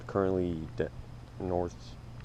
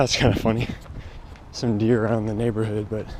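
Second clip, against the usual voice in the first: while running. Real, not acted. Filming mid-run after mile one.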